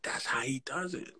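whispered, unvoiced realization; awed whisper. Watching [name] record and catching his trick, whispered. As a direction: breathe it out like a discovery. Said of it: "and I'm like".

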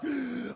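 sharp acted gasp for air; panicked gasp. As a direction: gasp as if short of breath. Panicked alone in the bathroom.

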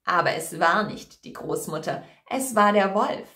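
normal talking voice

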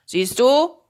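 loud, rising show-off question; boastful. Acted. Sorcerer shows the cat his trick, loud boasting.

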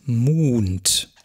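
Mund with a long u, like muhnt. Shown as a demonstration. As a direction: stretch the u in Mund. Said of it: "und das soll lang sein".

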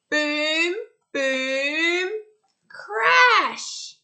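loud booms building to a high shouted crash; drum sounds. Acted. Storyteller voicing the noisy bear's drums, building to shout. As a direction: crash the last one like cymbals.

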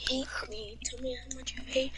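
whispered lyric under the breath; whispering. Pretend. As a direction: breathe the words without voice.

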